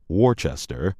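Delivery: first Worcester said "WOR-chester"; Worcester in three syllables, "WOR-chess-ter"; mispronounced. Shown as a demonstration. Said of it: "It is not".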